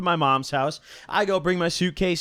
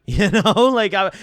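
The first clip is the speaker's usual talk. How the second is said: higher, louder laughing tag question; 3 semitones higher, 6 dB louder than usual. Real, not acted. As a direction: laugh as you check they get it. Laughs at own blunt confession.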